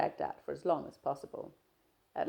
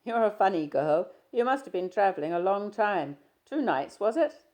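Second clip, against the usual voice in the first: a louder, lightly voiced young man, breezy; Stephen, teasing; 6 semitones higher, 10 dB louder than usual. Stephen greeting his shy friend, easy and teasing. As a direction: play Stephen chatting easily, a touch louder.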